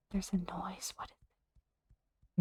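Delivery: hushed unvoiced whisper in the dark; slow tense whisper. Real, not acted. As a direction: breathe it, scared to be heard. Woke at midnight to noise.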